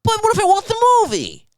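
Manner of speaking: very high whiny squeak; mocking Anthony. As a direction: whine it up high like a spoiled child. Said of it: "Anthony's like".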